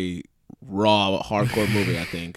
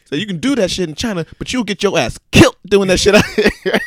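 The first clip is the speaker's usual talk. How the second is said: higher and louder, scolding rant; mock Chris Tucker rant; 8 semitones higher, 5 dB louder than usual. Chris Tucker scolding his partner, rising loud. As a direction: chew him out, building up. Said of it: "He's like".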